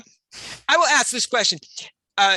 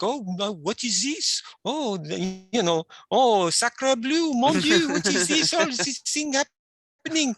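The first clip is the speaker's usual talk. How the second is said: high, breathy mock French accent; naive French lady; 6 semitones higher than usual. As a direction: play a flustered French ingenue with a French accent. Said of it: "and she's like".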